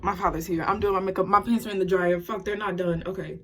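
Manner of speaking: ordinary delivery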